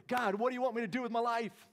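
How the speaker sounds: loud, high cry to God; fervent calling out. Acted. New convert calls to God on waking, fervent and loud.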